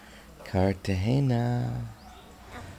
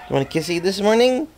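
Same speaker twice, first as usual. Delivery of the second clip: fast, higher cooing question; 6 semitones higher, much faster than usual. Real, not acted. Parent cooing to baby.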